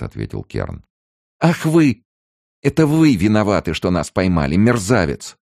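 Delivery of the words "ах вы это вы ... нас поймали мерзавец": high, loud outburst; furious accusation. A narrator's reading. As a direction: hurl the blame at him with an insult. Woman furiously accuses Kern.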